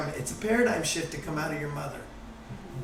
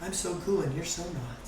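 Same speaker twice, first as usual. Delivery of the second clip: soft, smug put-down; smug cool; 8 dB quieter than usual. Retold or imitated. Fake-enlightened person needling an angry man, soft and smug. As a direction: say it quietly with smug superiority. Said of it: "Now you're just pissing him off".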